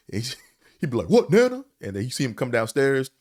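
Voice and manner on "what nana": high, loud put-on voice calling back; sleepy drunk nephew answering from upstairs; Sammy answering. Uncle answering grandma's call in a high, loud put-on voice.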